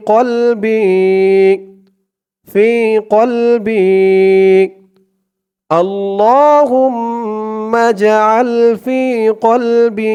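আল্লাহুম্মা drawn out very slowly with long hold; slow recitation. Shown as a demonstration. Teacher modelling pronunciation and elongation, slow recitation. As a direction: intone আল্লাহুম্মা with a long stretch. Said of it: "একালিফ টান".

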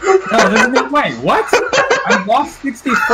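high, laughing disbelief; can't believe the score, laughing; shocked disbelief. Real, not acted. Gamer reacting to his stats, in laughing disbelief.

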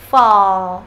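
last syllable "Fall" alone, loud, low and drawn out; final L. Shown as a demonstration. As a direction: isolate "Fall" to show its dark L. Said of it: "Âm L cuối này".

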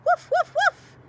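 very high, loud excited yaps; yappy little dog. Acted. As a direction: yap happily like a small dog.

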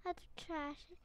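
normal talking voice

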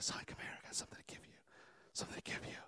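barely audible whisper; hushed, secretive whisper from a father. Acted. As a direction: whisper as if beckoning a son closer. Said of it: "how come you're whispering to me".

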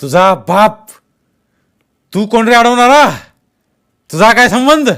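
loud, high, insolent comeback; angry shouted retort. A narrator's reading. Masked man insults Jaydeep for interfering, shouting angrily. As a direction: hurl it back at him, shouting.

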